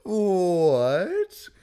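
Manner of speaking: high, loud, stretched rising question; loud disbelief. Acted. Recapper reacting to the gout joke, loud disbelief. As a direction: blurt it out in drawn-out shock.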